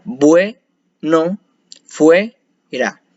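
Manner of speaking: words split by clear pauses; "bue" and "fue" held as single units, then a pause; syllable by syllable. Shown as a demonstration. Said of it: "No se separan en, en dos sílabas".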